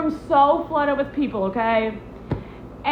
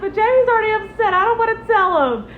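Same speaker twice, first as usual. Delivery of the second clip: panicky pleading; 8 semitones higher, 3 dB louder than usual. Retold or imitated. Pleading with friend about Jamie.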